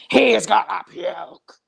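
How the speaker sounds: lightly imitated slow wrestling-boss yell; Vince McMahon shouting. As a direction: echo the boss's drawn-out call. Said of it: "I thought".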